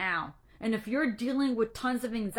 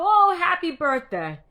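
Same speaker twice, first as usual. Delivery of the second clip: a higher, louder, hearty "Oh, happy birthday"; father's greeting; 5 semitones higher, 8 dB louder than usual. Retold or imitated. Imitating her father on the birthday phone call, hearty and higher. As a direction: burst out with the dad's cheerful birthday line. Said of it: "he would just go".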